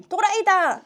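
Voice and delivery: high, loud playground shout; screaming. Retold or imitated. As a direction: yell it out like a child taunting. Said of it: "I would scream".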